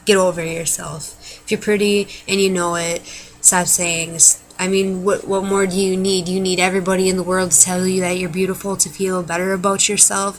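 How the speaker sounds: ordinary talk, nothing marked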